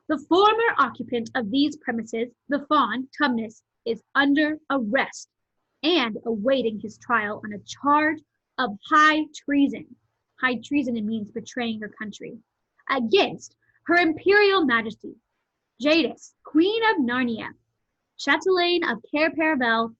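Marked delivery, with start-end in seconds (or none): 0.1-10.0 loud, measured official reading; reading out proclamation. A narrator's reading. Peter reads the arrest notice to the others, proclaiming. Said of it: "he read out the following words". 12.9-19.9 loud, higher, grand official titles; reading out proclamation. A narrator's reading. Peter reads out the queen's titles, grandly proclaiming. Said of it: "he read out the following words".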